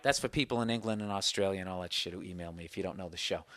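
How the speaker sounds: quiet aside. Real, not acted. Aside to foreign listeners.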